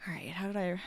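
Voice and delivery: whispered under her breath. Real, not acted. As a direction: breathe the words out with no voice. Muttering to herself over work.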